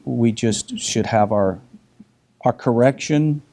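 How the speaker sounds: ordinary talk, nothing marked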